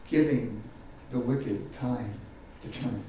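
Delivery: ordinary talk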